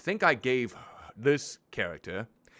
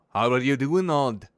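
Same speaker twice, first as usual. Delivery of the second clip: game character voice; 3 semitones higher, 6 dB louder than usual. Acted. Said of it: "what kind of accent did Rook have?".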